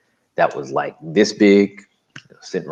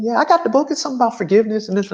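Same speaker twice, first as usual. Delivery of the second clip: high chatty nurse voice on the phone; breezy, much higher, girlfriend chatter; imitating the nurse; much faster than usual. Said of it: "she's like".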